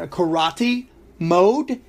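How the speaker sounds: ordinary talk, nothing marked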